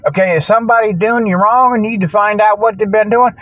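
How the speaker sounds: usual speaking voice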